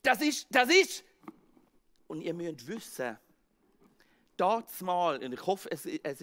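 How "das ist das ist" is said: higher, louder stammering in shock; frightened stammer. Acted. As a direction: stumble over the words in fear.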